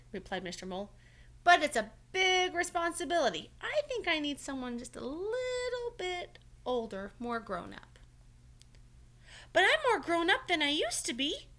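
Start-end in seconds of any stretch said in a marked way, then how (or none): 1.4-8.0 raised, careful grown-up mole voice; a kind older mole explaining; Mister Mole's voice. Acted. 9.5-11.5 put-on higher, louder child insisting. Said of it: "insisted Franklin".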